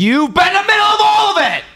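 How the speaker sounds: high, fast, yelled accusation; outraged shout. Acted. Host accusing the absent streamer, outraged shout.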